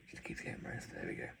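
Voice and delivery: whisper. Real, not acted. Whispered aside while fiddling.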